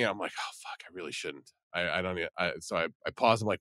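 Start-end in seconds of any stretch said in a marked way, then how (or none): 0.4-1.5 muttered reluctance. Retold or imitated. Inner reaction to stranger's plea.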